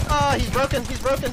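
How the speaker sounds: loud, high callout; excited callout. Real, not acted. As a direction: shout the callout in triumph. Calling enemy shield broken.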